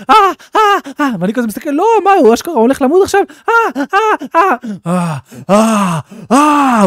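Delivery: high mock screams of a burning man; hammed-up burning screams; imitated screams. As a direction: wail high like someone on fire. Burning character screams in game.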